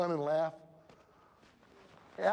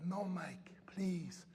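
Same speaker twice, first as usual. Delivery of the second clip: soft, breathy begging; whispered plea; 9 dB quieter, much slower than usual. Retold or imitated. The girl begging her coach to let her wait, whispered.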